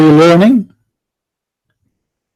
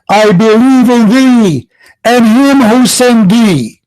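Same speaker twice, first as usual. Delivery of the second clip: slow, measured, a touch higher; slow solemn quote; 4 semitones higher, slower than usual. Retold or imitated. Host quoting the Prophet to caller, slow solemn recitation. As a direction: intone it like a sacred oath.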